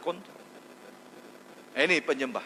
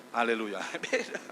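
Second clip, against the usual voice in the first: ordinary talk, nothing marked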